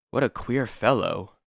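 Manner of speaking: normal talking voice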